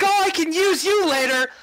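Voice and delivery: light up at the instrument; very high eager outburst; excited organizer. Acted. GM voices coordinator spotting instrument.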